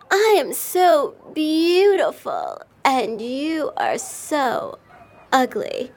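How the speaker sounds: slow, sneering emphasis; drawn-out taunt. A narrator's reading. Kitten sneering at the puppy, slow and high. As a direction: stretch the insult out.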